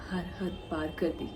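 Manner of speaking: soft, low echo falling away; hushed, downcast. Acted. Woman telling how far Atif went to leave her, soft and downcast. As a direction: let the voice sink quietly.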